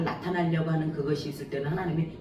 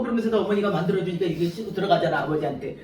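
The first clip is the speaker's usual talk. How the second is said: ordinary talk, nothing marked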